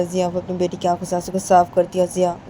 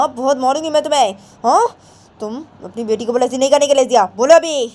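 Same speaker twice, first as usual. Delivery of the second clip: angry threat; 4 semitones higher, 6 dB louder than usual. Acted. Grandmother threatens Jiya after slap.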